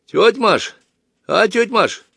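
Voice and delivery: put-on high girlish call; acted high-pitched hail. A narrator's reading. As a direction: call for your aunt across the carriage. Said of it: "крикнула одна из девушек".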